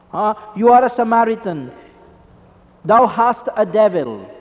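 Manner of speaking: loud, scornful jeer; jeering accusation. Retold or imitated. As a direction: hurl the insult at him.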